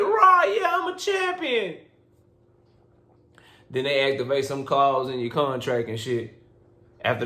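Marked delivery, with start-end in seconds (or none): 0.0-1.8 high, giddy mock cheer; mock excited champion. Fighter gloating over his new title, mock excited. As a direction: play the fighter thrilled with his belt, much higher.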